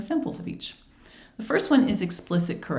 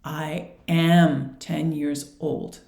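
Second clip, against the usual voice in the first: slow, spaced words with "am" held; teacher's careful model, stressing "am"; slow model repeat; much slower than usual. Shown as a demonstration. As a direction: model the sentence slowly for repeating. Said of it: "Can you repeat?".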